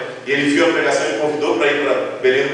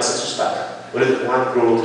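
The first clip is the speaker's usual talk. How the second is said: normal talking voice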